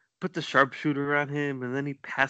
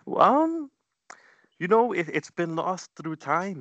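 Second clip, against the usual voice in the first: hesitant hedging; 6 semitones higher, 3 dB louder than usual. Real, not acted. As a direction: stall loudly in a squeaky-high pitch. Dodging whether they recorded before.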